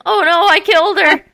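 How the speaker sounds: high-pitched imitation of alarm; mock panicked cry. Recalling the splash, then cousin's cry, mock panicked wail. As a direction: mimic the cousin crying out in panic.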